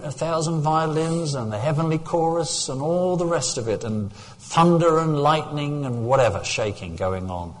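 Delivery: loud, ringing preacher's voice; preaching. Acted. Pastor preaching to the church, loud and ringing. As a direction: preach it out to the whole congregation.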